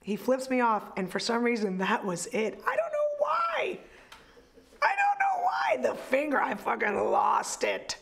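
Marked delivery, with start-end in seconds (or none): 2.7-5.8 exasperated shout. Real, not acted. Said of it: "repeated, louder second".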